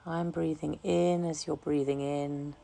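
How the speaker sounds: calm, unhurried meditation voice, softening gradually; guided meditation. Real, not acted. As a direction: guide listeners gently into relaxation, leaving long pauses. Leads a relaxation meditation.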